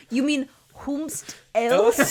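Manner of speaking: teasing grammar snob; mock pompous. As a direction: draw out "whomst" with mock grandeur. Mock-correcting someone's grammar.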